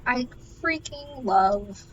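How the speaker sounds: ordinary delivery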